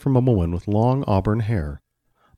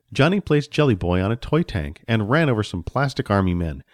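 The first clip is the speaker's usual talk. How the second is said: usual speaking voice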